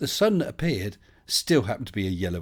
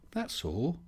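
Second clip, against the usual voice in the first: "That's all" up high and soft; softer, higher repeat; higher, softer character voice; 6 semitones higher, 6 dB quieter than usual. Shown as a demonstration. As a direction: echo it back in a put-on voice.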